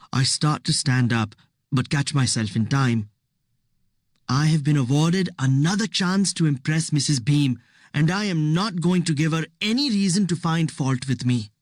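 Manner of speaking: plain reading voice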